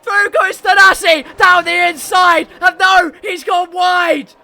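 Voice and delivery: loud, high shouted commentary; frantic race call. Real, not acted. Commentator watching Thanasi go wide, frantic shouting. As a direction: cry out the drama as it happens. Commentator calls failed last-lap attack.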